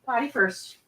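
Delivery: high, soft coaxing; soft high pet voice; puppy talk. Real, not acted.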